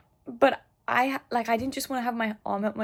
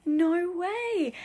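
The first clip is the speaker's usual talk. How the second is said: imitated surprise; 9 semitones higher than usual. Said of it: "he was like".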